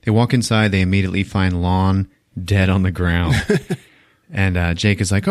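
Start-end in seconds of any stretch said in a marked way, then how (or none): none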